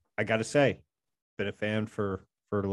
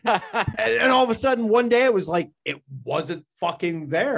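high, loud, laughing retelling; bursting with glee over it; excited; 9 semitones higher, 4 dB louder than usual. Real, not acted. Excited retelling about intro.

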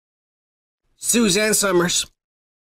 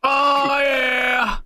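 excited; 9 semitones higher than usual. Real, not acted. Excited about getting Britney on.

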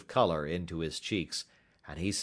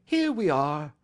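higher, cheery welcome; cheerily; 8 semitones higher, 5 dB louder than usual. A narrator's reading. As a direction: announce it brightly to the guest. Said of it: "said Holmes cheerily".

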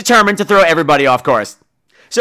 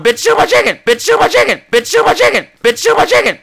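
high, loud chant of the same line; frantic repeating; 11 semitones higher, 4 dB louder than usual. Retold or imitated. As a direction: rattle it off over and over like a stuck record. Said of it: "Over and over".

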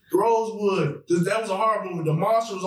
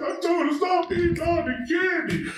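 mock yell; 10 semitones higher than usual. Quoting a movie character's complaint.

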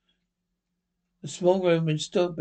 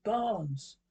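hushed, higher-pitched call; whispered call; 4 semitones higher, 6 dB quieter than usual. A narrator's reading. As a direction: call the name softly but urgently. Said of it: "he whispered".

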